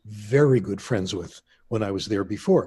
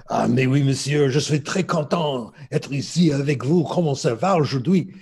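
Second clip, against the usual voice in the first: hammed-up French accent, higher and louder; exaggerated French; 3 semitones higher, 4 dB louder than usual. Student mocking his French examiners in a hammed-up French accent. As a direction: lay the French accent on thick to mock the teachers. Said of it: "totally exaggerated everything French".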